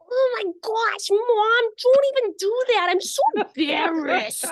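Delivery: put-on high-pitched baby voice for an embarrassed dog; Hudson. Owner voicing her shy dog to mum, high baby voice. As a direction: squeal it slowly like a mortified baby animal. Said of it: "So, so Hudson sounds like this".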